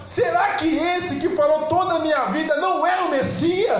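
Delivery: high-pitched, driving sermon delivery; fervent preaching. Real, not acted. Preacher telling of the woman who ran to tell everyone, fervent preaching. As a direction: preach it in one excited, rising rush.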